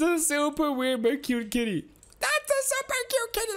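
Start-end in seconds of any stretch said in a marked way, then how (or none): 2.2-3.5 high cutesy coo. Real, not acted. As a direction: gush over the kitty. Cooing at a cute kitty.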